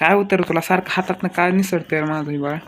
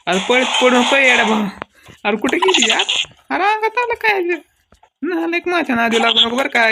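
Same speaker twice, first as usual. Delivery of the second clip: yelling after doll; 8 semitones higher than usual. Acted. Chasing the runaway doll, yelling.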